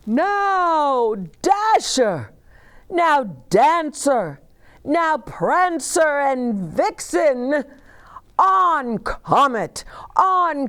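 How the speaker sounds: loud, high rousing roll call. Acted. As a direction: call the team out across the sky. Santa calls reindeer by name.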